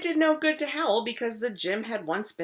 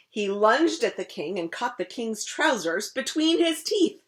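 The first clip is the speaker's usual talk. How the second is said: usual speaking voice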